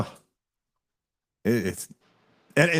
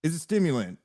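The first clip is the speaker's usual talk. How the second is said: mock lecturing; 7 semitones higher than usual. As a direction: spell it out to him, climbing higher. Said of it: "I said".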